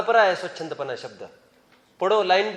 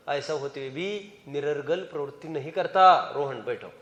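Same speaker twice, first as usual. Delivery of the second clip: normal talking voice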